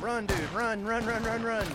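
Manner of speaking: frantic loud urging; being chased. Real, not acted. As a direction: yell at a fleeing teammate. Teammate fleeing enemy fire.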